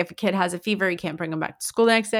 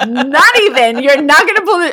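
loud, raised no-nonsense mom voice; firm refusal; 5 semitones higher, 8 dB louder than usual. Retold or imitated. Mom refuses daughter's bedtime excuse, firm mock scolding. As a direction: shut it down, high and laughing. Retold refusal to worried child.

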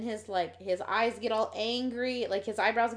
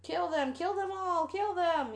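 higher-pitched mock kill chant; egging the body on; 8 semitones higher, slower than usual. Co-host voices the doll ordering kills, egging it on. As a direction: urge it on, high and insistent. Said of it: "telling it to kill them".

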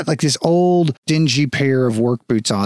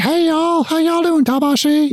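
much higher, brighter greeting; friendly guard; 14 semitones higher than usual. Acted. Guard spotting Tabashi and calling out, high and glad. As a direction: hail an old friend up high.

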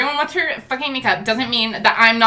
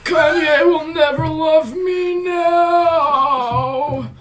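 slow, sing-song hammed-up weeping; mock sobbing lament; 5 semitones higher, much slower than usual. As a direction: wail it like a melodramatic villain. Villain withered, laments.

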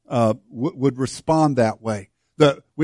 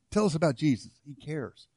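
hushed; 9 dB quieter than usual. Acted. Soft, fading testimony.

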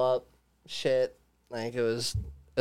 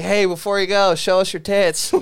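higher, louder mock heckle, laughing; cheeky comedian voice; 5 semitones higher, 5 dB louder than usual. Retelling the comedian teasing the woman on camera, cheeky loud. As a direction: play the crude comedian teasing her. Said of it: "he's like".

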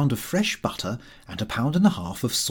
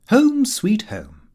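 fond slow motto; 8 semitones higher, 4 dB louder, much slower than usual. Acted.

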